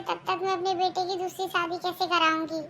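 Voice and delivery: usual speaking voice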